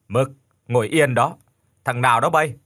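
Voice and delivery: lightly voiced old man calling out; a hint of an old man checking who's there; aged voice. A narrator's reading. Old man quiets his barking dog and challenges the visitor, aged voice. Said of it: "Một giọng già nua vang lên".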